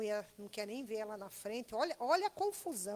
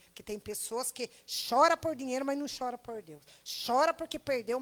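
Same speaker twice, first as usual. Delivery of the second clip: forceful preaching; 6 dB louder than usual. Acted. Preaching with loud punches.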